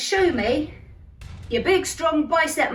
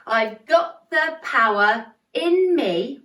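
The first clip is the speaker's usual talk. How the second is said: slow, got and power punched; stress on got and power; 3 dB louder, much slower than usual. Shown as a demonstration. Teacher leading the chorus, slow stressed got and power. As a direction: lean on got and power slowly. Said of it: "They're where the emphasis comes".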